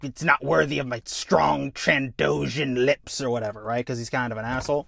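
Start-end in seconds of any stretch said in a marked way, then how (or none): 0.0-3.2 slow, lightly haughty character voice; mild haughty disdain. Acted. Borsk refusing to learn the language, mildly haughty. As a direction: draw it out with a touch of disdain.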